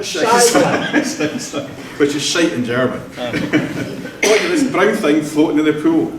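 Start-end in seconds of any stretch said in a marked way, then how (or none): 0.0-1.7 loud, high Scheiße, then dropping off; mock German shout. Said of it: "starts shouting". 3.2-6.1 laughing. Real, not acted. Laughing through the pool story.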